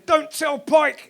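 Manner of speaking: a slow, pompous mock Dad's Army order; Captain Mainwaring impression. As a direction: drag it out like a flustered old captain. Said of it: "Captain Mannering".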